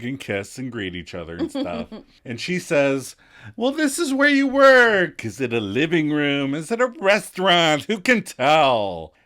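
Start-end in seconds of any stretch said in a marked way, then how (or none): 3.5-9.1 snooty. Retold or imitated. Mother quoted snooty in story.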